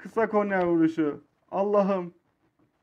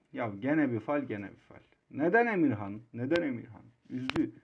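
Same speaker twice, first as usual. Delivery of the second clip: dejected; 10 semitones lower, 9 dB quieter than usual. Real, not acted. Disappointed gamer after missed chance.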